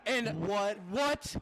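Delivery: ecstatic play-by-play yell; excited shout. Real, not acted. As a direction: scream the winning kick call. Game-winning field goal call.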